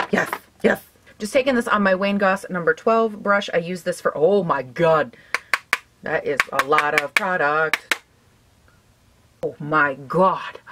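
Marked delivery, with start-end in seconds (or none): 4.2-10.6 stunned. Real, not acted. Too much blush on brush.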